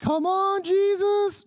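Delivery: high ecstatic call to Jesus; fervent cry. Acted. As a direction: cry out his name.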